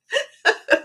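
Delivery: high trailing chuckle; laughing. Real, not acted. Telling Chris about the clerk, a last real chuckle. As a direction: let the laugh run out.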